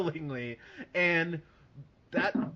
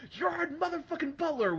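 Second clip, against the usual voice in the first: high, gleeful fan cry; excited fan shout. Retold or imitated. Moviegoer cheering Gerard Butler on screen, gleeful shout. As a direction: cheer him like a hero on screen. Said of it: "I was like".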